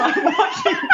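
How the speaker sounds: high squeak breaking into laughter; helpless laughing squeal. Real, not acted. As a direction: dissolve into giggles while saying it.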